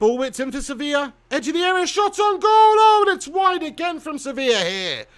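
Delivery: loud, high excited football commentary; live play-by-play. Real, not acted. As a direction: build to a shout on the shot, then ease off.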